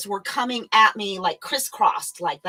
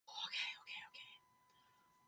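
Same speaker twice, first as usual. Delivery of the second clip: breathy whispered okays to herself; calming down; 28 dB quieter than usual. Real, not acted. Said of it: "I have to calm down".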